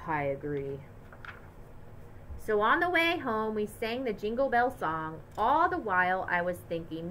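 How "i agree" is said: weary dad. A narrator's reading. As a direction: agree with a worn-out sigh. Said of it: "sighed and said".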